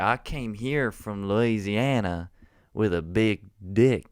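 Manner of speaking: slow, swaggering mock Southern accent; Southern gentleman. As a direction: boast it in a drawn-out Southern imitation. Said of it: "there was this Southern gentleman there".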